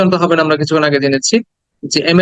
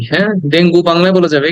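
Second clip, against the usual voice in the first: ordinary talk, nothing marked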